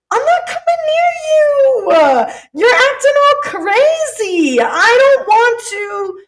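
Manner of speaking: high, loud, drawn-out mock wail; over-the-top exasperated yell; dramatic shouted refusal. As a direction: shout it as the universe refusing to come closer. Acting the universe refusing a Karen.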